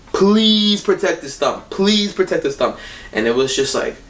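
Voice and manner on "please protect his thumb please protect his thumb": pleading prayer. Retold or imitated. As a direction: beg the Lord for his thumb. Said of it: "I was like".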